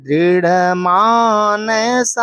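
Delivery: slow, careful दृढ़ मानसा with a long Mansa; slow pronunciation. Shown as a demonstration. Teacher modelling the answer for students: slow, careful. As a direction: say दृढ़ मानसा slowly, drawing out Mansa. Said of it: "उच्चारण कर रहे हैं ना आप इसका".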